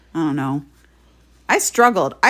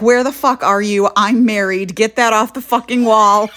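retold angry demand, louder; furious; 5 dB louder than usual. Married man to women who called his number, retold fury. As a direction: imitate an outraged man on the phone. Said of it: "he was furious".

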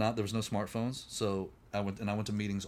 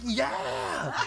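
excited cheer; 4 dB louder than usual. Retold or imitated. Cheering his own sobriety.

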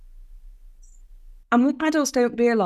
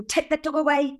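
imitated high-pitched alarm; mock panicky high voice; alarmed mother; 8 semitones higher than usual. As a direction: act out a mother urgently warning people off. Retelling her mother's alarmed order.

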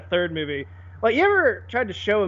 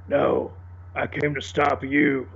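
Batman voice; 4 semitones lower, slower than usual. Retold or imitated. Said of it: "Batman looks at him and goes".

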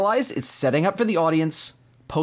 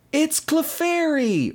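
high, slow reveal voice; dramatic high reveal; announcer reveal; 13 semitones higher, much slower than usual. Retold or imitated. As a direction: announce the answer like a TV quiz. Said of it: "it's like".

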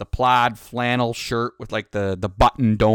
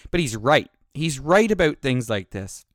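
usual speaking voice

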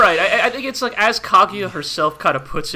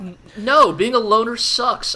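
emphatic retold protest, higher and louder; Ishigami; 7 semitones higher, 6 dB louder than usual. Host voices a loner rejecting solitude, emphatic protest. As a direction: push back firmly in a higher quoted voice. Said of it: "But Ishigami's like".

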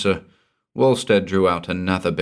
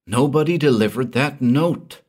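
much higher, anxious explaining; frightened Walstead; 8 semitones higher, 4 dB louder than usual. Acted. Frightened man explaining his ruin, anxious and high. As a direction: plead your case in distress. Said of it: "Walstead repeated".

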